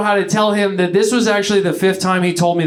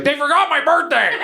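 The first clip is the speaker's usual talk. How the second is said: whiny dad; 7 semitones higher than usual. Retold or imitated. Dad whining about friends.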